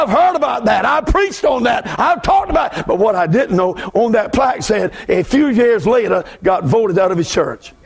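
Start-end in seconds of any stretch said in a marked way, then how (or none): none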